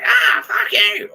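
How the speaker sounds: high, drawn-out yell of abuse; mock scream. Imitating a neighbour screaming through the wall. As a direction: scream it slowly like a neighbour losing it.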